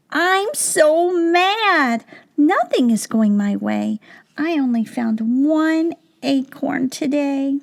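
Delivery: high, slow, stretched-out mock anger on "I'm so mad"; mad squirrel. Squirrel complaining to Pete, hammed-up angry. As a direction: whine it like a cross little squirrel. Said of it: "Squirrel did not look happy".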